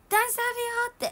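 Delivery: high, loud shouted warning. Shown as a demonstration. To the boss at a fancy place, a loud shout. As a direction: yell it out to warn her. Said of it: "って感じで".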